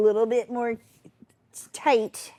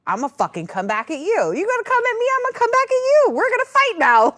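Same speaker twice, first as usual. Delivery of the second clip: high loud fighting talk; mock-fierce mom yelling, pitch way up; mom mode; 7 dB louder, faster than usual. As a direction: rear up like a protective mom. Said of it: "now I'm in mom mode".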